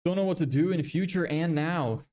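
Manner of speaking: normal talking voice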